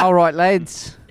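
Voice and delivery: rally them in comic Cockney; put-on Cockney accent. Host rounding up the lads, loud mock Cockney accent. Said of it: "Now you do it Australian Cockney".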